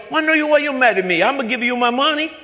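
high, loud, pleading broken English; mock-naive broken-English caller. Put-on caller feigning hurt to scammer.

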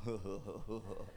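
low breathy huffing; imitated huffing. Preacher stepping back, low huffing breaths. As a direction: breathe out short low huhs. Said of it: "I heard him kinda, like, going".

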